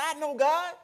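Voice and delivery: high, loud indignant retort; retold loud argument. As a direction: fire it back as in a quarrel. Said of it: "we had that discussion".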